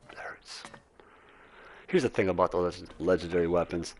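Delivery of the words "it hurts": whispered in pain; whispering. Real, not acted. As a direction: breathe it out as a whisper. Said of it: "Oh, it hurts".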